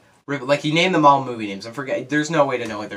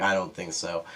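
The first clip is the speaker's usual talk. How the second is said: Bruce Willis deadpan; 6 semitones lower, 5 dB quieter than usual. Retold or imitated. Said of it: "he's like".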